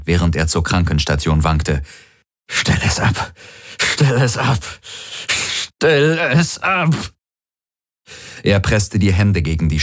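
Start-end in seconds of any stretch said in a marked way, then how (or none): 2.5-3.3 breathless whisper in pain; anguished whisper. A narrator's reading. Garland begging the Helix to stop, whispered anguish. 3.8-6.9 climbing higher, drawn out and desperate at the end; rising anguished cry. A narrator's reading. Garland tormented by the Helix, rising desperate cry.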